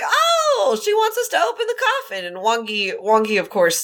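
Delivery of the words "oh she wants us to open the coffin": high, amazed voice; high mock realization. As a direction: act out sudden realization. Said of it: "Wei Wuxian's like".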